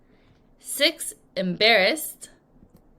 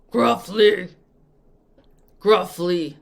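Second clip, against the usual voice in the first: gruff demo voice; 2 semitones lower, 6 dB louder, much slower than usual. Shown as a demonstration. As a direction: growl the word out gruffly. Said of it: "Sorry, I'll say it in my regular voice".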